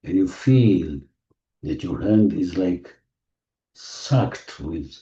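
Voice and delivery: slow, gentle meditation voice; guided meditation. Acted. Meditation leader guiding the group through healing, soothing. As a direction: ease them into the feeling, unhurried.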